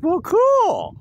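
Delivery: high, loud, drawn-out cheer; hammed-up happy squeal; retold delighted mom. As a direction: imitate mom's sudden delight with a big swoop up. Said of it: "And she's like".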